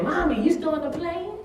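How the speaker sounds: put-on high little child voice; lightly imitating a toddler; baby's question. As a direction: mimic a small child asking. Baby voice quoted by speaker.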